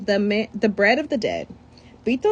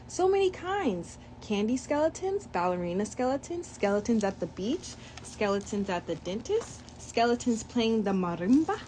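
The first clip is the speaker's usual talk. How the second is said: usual speaking voice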